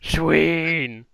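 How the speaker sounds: drawn-out, loud mock call; Penny. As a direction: imitate a character hollering a name, stretched out. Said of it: "Like how Enma does Penny".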